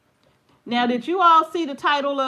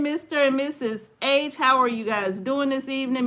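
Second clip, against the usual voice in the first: ordinary talk, nothing marked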